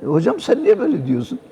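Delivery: put-on inner young voice. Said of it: "dedim içimden".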